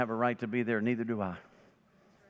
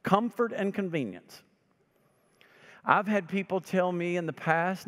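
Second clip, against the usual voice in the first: ordinary talk, nothing marked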